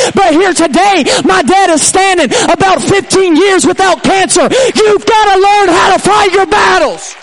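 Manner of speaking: loud, high-pitched testimony shouted out; preaching at full force. Real, not acted. Preacher testifying to dad's healing, full-force shouting. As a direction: cry it out to the whole church, stretching the climaxes.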